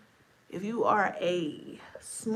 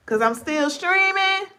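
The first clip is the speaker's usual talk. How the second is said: excited; 10 semitones higher, 11 dB louder than usual. Real, not acted. Loud high exclaim, laugh follows.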